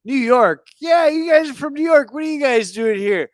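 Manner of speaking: put-on hollering at them like a drunk; drunken shout. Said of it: "I'm kinda drunk".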